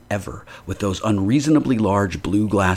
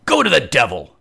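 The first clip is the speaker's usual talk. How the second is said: loud, higher angry roar; tremendous voice; 6 semitones higher, 7 dB louder than usual. Acted. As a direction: roar at the intruder. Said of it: "said the stranger in a tremendous voice".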